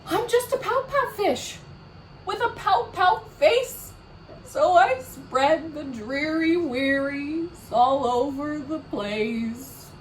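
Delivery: hammed-up gloomy fish; dramatic pout pout fish. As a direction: wail the refrain like a sulking fish, slowing down. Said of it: "He is a very dramatic pout pout fish".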